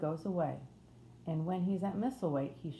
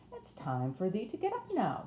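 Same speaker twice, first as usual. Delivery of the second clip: higher-voiced Yorkshire housemaid; broad Yorkshire accent; 5 semitones higher than usual. A narrator's reading. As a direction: wake them in broad Yorkshire, pitched up. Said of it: "in a broad Yorkshire accent".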